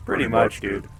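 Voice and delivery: ordinary delivery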